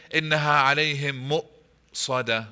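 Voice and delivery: إِنَّهَا عَلَيْهِمْ then a full pause before مُؤْصَدَةٌ; pausing at every hamza. Shown as a demonstration. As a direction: stop completely at the hamza in مُؤْصَدَةٌ. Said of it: "like this".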